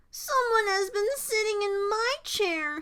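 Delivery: put-on high small-child wail; tearful Baby Bear. A narrator's reading. Baby Bear seeing his worst fears realized, tearful. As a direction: pipe it high, close to tears. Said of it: "Baby Bear started to cry".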